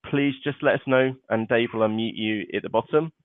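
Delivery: ordinary delivery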